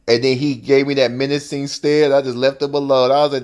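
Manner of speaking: normal talking voice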